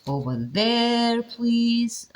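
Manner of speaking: slow, drawn-out stretching of each word; stretched words. Shown as a demonstration. Lecturer showing stretching for emphasis, slow and drawn out. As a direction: exaggerate the stretch to make the point. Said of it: "also stretching".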